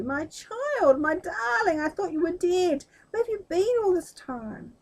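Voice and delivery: mother overflowing with relief; a mother's tearful, happy welcome; cried out with joy. A narrator's reading. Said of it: "she cried out with joy".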